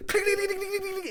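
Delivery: very high, rapid pinging cling noises; arrow sound effect. Retold or imitated. Describing the wolf's arrow volley, high pinging mouth effect. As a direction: mimic arrows firing back to back. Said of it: "he's just like".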